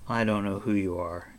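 plain reading voice

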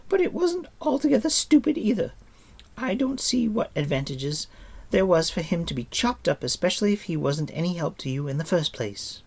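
put-on high-pitched girl's voice; frank, high-voiced young heroine; Eilonwy, reasoning. A narrator's reading. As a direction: argue briskly, pitched well up.

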